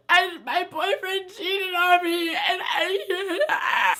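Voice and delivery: slow, laughing-through-tears mock sob; fake sobbing. Drunk girl fooling officer, slow mock sob. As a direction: ham up a drunk breakup cry.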